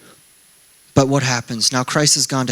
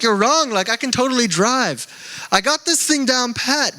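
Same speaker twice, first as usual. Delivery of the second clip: mock stubborn husband; 8 semitones higher than usual. Retold fight with wife.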